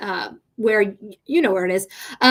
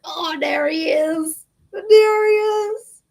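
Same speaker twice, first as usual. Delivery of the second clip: high, slow, stretched cooing; 6 semitones higher, 3 dB louder, much slower than usual. Real, not acted. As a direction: coo at the cat lovingly. Cooing at cat showing himself.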